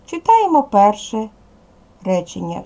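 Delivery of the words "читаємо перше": ordinary talk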